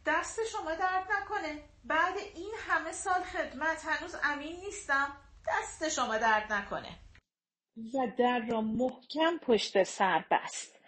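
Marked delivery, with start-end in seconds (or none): 0.1-7.0 a hint of a hurt old man's grumble, higher and softer; grumbling. A narrator's reading. Old servant grumbling as he leaves the room, mildly sulky. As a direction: mutter it under the breath, offended. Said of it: "غر زد".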